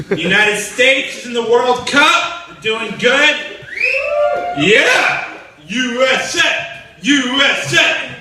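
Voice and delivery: hammed-up patriotic cheer; excited American fan. Mocking American fans cheering their team.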